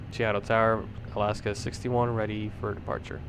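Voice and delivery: radio-filtered cockpit call; over a pilot headset. Real, not acted. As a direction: through the headset mic.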